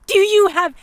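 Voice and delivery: a lady reminding him of her rank; indignant outburst. A narrator's reading. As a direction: burst out in noblewoman outrage. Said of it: "burst out of me".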